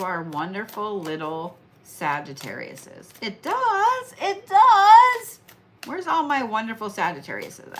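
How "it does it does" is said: much higher, squealing it does; excited squeal. Acted. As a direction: squeal with delight. Answering own question excitedly.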